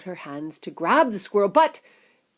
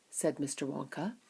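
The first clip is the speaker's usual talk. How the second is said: usual speaking voice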